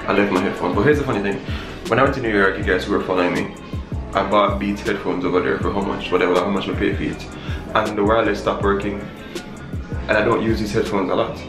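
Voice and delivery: ordinary talk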